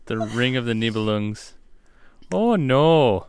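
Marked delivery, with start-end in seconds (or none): 2.3-3.3 mock Minnesotan lament, pitched up; Minnesota accent. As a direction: put on a higher Minnesota accent. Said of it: "maybe that's more of Minnesota Opera".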